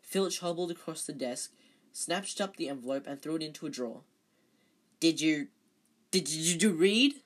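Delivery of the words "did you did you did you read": loud flustered spluttering, tripping over the words; a caretaker spluttering loudly at a student. A narrator's reading. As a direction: splutter it out, panicked and cross. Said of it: "he spluttered".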